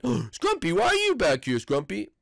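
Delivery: ordinary delivery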